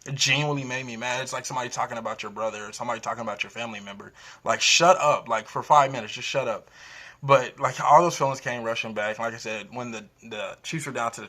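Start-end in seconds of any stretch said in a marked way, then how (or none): 4.4-6.7 loud exasperated shut up. Real, not acted. Venting at people mocking his team.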